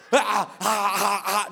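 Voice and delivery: long, drawn-out possessed wail; mock demonic howling; imitated demon cries. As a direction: cry out slowly as if possessed. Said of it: "she started manifesting a demon, full blown".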